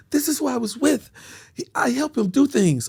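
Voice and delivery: put-on high, explaining girlfriend voice; high-pitched mock woman; imitating woman friend. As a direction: explain it primly in her high voice. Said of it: "She like".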